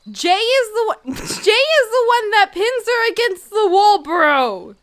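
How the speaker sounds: high, loud, insistent shouting; exasperated correction. Real, not acted. Setting a confused friend straight on Jay, exasperated shout. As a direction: insist at full volume, half laughing.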